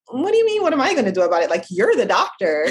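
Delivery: high, incredulous mock protest; a surprised "who, me?" retort; retold disbelief. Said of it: "And I'm like".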